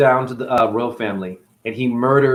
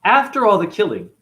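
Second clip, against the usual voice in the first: ordinary delivery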